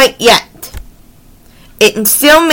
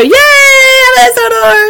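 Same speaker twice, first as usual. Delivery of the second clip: high, stretched-out mock crowd yay; playful cheer; 12 semitones higher, 3 dB louder than usual. Reader celebrates the Island of Sodor, high playful cheer. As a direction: cheer in a high held voice, drawing it out.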